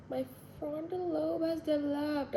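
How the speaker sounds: high, slow mock German accent; Jake Peralta's German accent. Vlogger joking about turning twenty-five, mock German accent. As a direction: draw it out in a put-on German accent. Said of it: "Jake Peralta's German accent".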